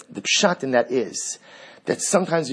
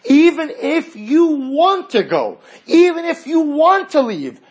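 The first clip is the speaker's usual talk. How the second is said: high, loud, slow insistent preaching; as King David, refuse to let God leave; stark demand; 10 semitones higher, 5 dB louder, slower than usual. Acted. Rabbi voicing King David to God, loud and unyielding. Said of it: "understand the starkheit in this dialogue".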